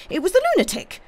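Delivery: very high, loud frantic repeat; wild fatigue, escalating. A narrator's reading. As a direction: insist harder, pitch shooting right up. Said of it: "with a sort of wild fatigue".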